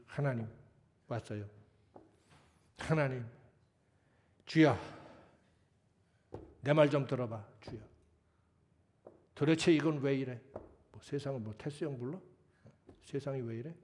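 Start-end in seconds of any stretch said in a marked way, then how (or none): 0.1-10.4 loud, scolding cry at God; demanding prayer. Retold or imitated. Preacher mocking a believer who scolds God in prayer, loud and demanding. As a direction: nag God like a spoiled child. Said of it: "야단치듯이, 하나님께 따지듯이, 투정 부리듯이".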